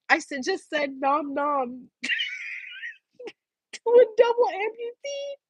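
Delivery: words breaking up into real laughter; can't hold it together, laughing mid-sentence; laughing through words. Real, not acted. To the audience, about joking with an amputee, laughing helplessly. Confessing she mocked an amputee.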